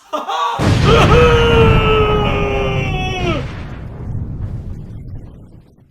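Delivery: high, loud sustained cry as he floats off; long floating whoop. Acted. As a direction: let out a long wail while drifting up. Air mattress floats away.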